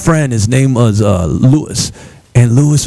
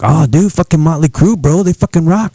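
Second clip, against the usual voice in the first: excited teen friend, lightly put-on accent, a bit higher; Lewis accent; 6 semitones higher than usual. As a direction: hype up the band like a stoner kid. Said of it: "that's my Lewis accent".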